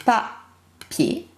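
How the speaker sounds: "Papier" in two separate beats, pa, pier; split into syllables. Shown as a demonstration. Teacher counting syllables for pupils, "Papier" syllable by syllable. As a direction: say "Papier" with a clear gap after "Pa". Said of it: "Deux syllabes".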